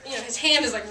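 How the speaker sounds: ordinary delivery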